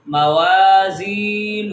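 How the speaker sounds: موازینہ slow, waa, zee and final hoo held; three long vowels. Shown as a demonstration. Teacher drills madd letters, موازینہ drawn out. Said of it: "میم اور نون ان دو کو لمبا نہیں".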